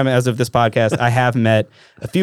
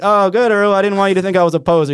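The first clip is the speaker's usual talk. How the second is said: relieved exclamation; 9 semitones higher, 4 dB louder than usual. Retold or imitated. His own relieved reply retold.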